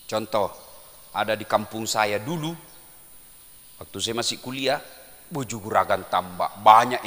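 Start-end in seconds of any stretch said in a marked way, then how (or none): none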